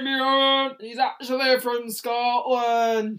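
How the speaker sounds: the cow explaining where he is from, lightly Scottish; Scottish accent. Acted. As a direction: say it in a Scottish burr. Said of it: "that Scottish accent I'm doing".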